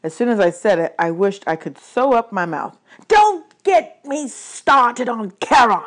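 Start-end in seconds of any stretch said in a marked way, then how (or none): 3.1-5.8 slow, high, exasperated yell. A narrator's reading. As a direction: yell it out word by word. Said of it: "Hades yelled".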